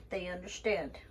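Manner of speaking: ordinary talk, nothing marked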